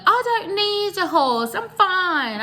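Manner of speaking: high, slow put-on voice for the stubborn uncle; brushing it off in a high, slow voice; mock old uncle. Uncle insisting he's fine, in a high mock voice.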